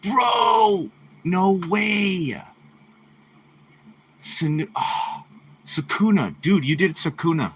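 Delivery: high hyped bro reaction; amazed, pumped-up dude energy; frat bro. Real, not acted. Hyped by fan art.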